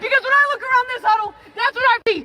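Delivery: very high falsetto huddle speech; mocking a woman's pep talk. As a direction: squeak it like a female coach firing up the team. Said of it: "Let's hear 'em motivate you".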